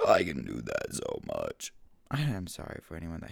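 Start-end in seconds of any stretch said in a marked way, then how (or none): 0.1-1.7 deep slow voice. Acted. Deep voice right after whisper.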